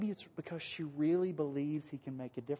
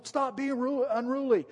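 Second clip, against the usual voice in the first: higher, lightly voiced scold; mock scolding; 7 semitones higher than usual. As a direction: play a scolding bystander. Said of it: "a bunch of Wisconsin people".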